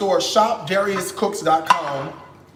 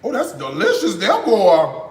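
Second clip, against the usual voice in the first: ordinary delivery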